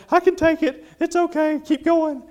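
imitating a tearful patient, high and loud; mock crying. Dentist mimics his numbing-resistant patient urging him on, mock crying. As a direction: put on a high, brave-through-tears voice. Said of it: "She's crying and saying".

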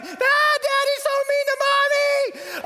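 hammed-up child crying about Daddy; wailing child. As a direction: blubber it like a small upset child. Crying kids after parents argue.

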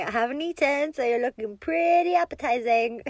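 loud, lively mock threat; playful teasing. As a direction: tease them boldly and playfully. Teasing the bundled-up partner.

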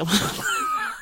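high bubbly giggle; a bright little laugh. Real, not acted. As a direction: giggle at your own cheek. Laughing at own joke.

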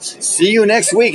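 high sing-out, drawn out; showman punchline. Retold or imitated. Magician to audience at the reveal, showy high-pitched punchline. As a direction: deliver the trick's payoff, high and slow.

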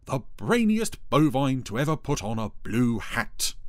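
pompous sarcasm; sarcastic Dougal. A narrator's reading. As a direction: proclaim it with withering grandeur. Dougal mocks Ermintrude.

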